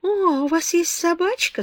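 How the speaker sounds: high, dreamy gush of joy; light up though half asleep; drowsy girl thrilled to hear of a dog; sleepy delight. A narrator's reading. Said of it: "сонно просияла она".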